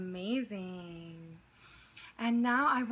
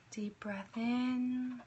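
whisper; 5 dB quieter than usual. Acted. Whispered breathing cues.

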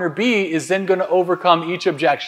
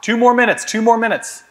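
higher, louder time call; 6 semitones higher, 4 dB louder than usual. Real, not acted. Coach calling time to room.

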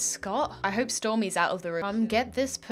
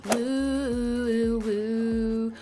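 ghost woo; much slower than usual. Pretend. Mock ghost hooting.

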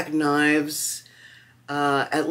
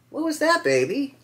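high, cooing question to the cat; talking to a pet; 14 semitones higher than usual. Real, not acted. Checking on the noisy cat, high pet voice. Calls to the cat mid-review.

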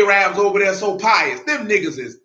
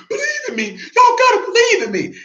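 mock screaming plea. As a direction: parody a desperate preacher screaming for belief. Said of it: "didn't walk around screaming".

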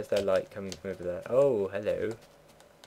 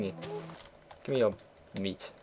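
drawn-out, softer mock menace; slow mock demand; 7 dB quieter, much slower than usual. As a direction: stretch each word like a playful threat. Demanding meat from a cow.

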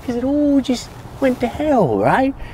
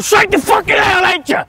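accusing shout; 10 semitones higher than usual. Acted. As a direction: build to a yell. Accusing Tommy of killing.

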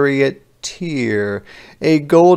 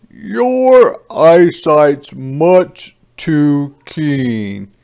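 slow, loud stern scolding; father scolding his son for tall tales, sternly and loudly; stern dad; 6 semitones higher, 6 dB louder than usual. A narrator's reading. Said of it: "he looks at me and sternly says".

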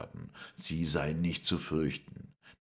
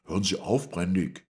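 raised voice, a little high; called out; 5 semitones higher, 5 dB louder than usual. A narrator's reading. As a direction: call out to make him stop. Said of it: "rief Montgomery". (mit erhobener Stimme gerufen)

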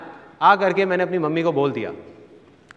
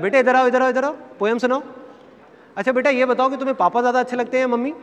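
put-on higher-pitched, cooing call to a child; coaxing relative; 7 semitones higher than usual. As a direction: imitate grown-ups summoning a kid to perform. Adults coaxing child to perform. (बच्चे को पुचकारकर बुलाते हुए)

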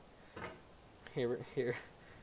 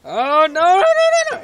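very high, loud string of noes; panicked yelling; 14 semitones higher, 15 dB louder than usual. Real, not acted. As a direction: scream it as control slips away. Player is sent flying in game.

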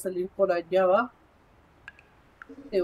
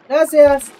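loud thanks; 3 semitones higher, 12 dB louder than usual. Real, not acted. Thanking in-game helper.

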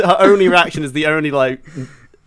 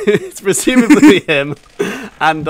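ordinary talk, nothing marked

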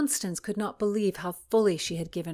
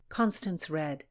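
ordinary delivery

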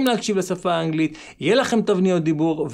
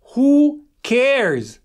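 dismissive; 6 semitones higher, 5 dB louder than usual. Retold or imitated. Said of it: "מה אני תמיד אומר".

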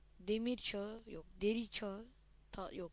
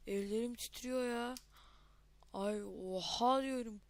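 normal talking voice

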